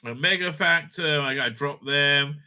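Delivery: ordinary talk, nothing marked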